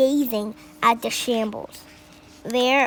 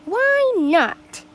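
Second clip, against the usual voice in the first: put-on boy's shrug; 9 semitones higher, 4 dB louder than usual. A narrator's reading. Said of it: "he thought".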